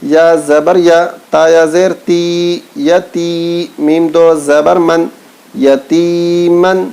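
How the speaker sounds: slow, chanted spelling recitation; Quran primer recitation. Shown as a demonstration. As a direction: chant each syllable slowly like a Quran class.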